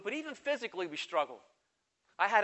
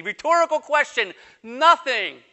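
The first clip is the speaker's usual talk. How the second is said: loud, higher preaching voice; preacher proclaiming to the congregation; full force preaching; 4 semitones higher, 9 dB louder, slower than usual. Acted. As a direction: drive the answer home.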